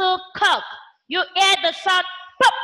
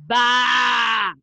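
sheep bleat; 4 semitones lower than usual. Shown as a demonstration. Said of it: "like a sheep".

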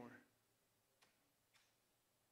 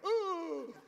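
high startled ooh with a laugh; surprised ooh; 8 semitones higher than usual. Retold or imitated. Player sees his hand, startled ooh. Finger popped out, reacting.

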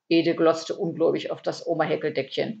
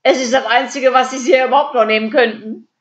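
high, loud jibe; gleeful taunt; 6 semitones higher, 8 dB louder than usual. A narrator's reading. Käthe taunts the burglar with empty room, gleeful. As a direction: crow it at the thief.

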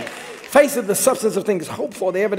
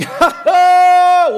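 loud, high scoffing laugh; Sarah cackling in disbelief; a shouted, high scornful laugh; mocking laugh; 12 semitones higher, 14 dB louder than usual. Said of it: "and she starts laughing".

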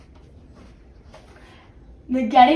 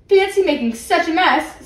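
admiring; 6 semitones higher, 6 dB louder than usual. A narrator's reading. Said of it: "said Jonathan admiringly".

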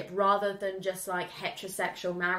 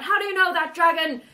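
loud, high mock demand; hammed-up shouted question; demanding outburst; 7 semitones higher, 8 dB louder than usual. Bowen challenges the dragon round the fire, mock shouting. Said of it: "And he's like".

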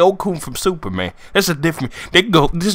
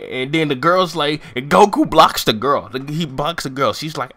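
ordinary delivery

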